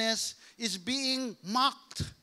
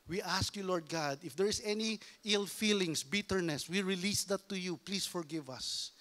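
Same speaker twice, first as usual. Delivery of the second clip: ordinary delivery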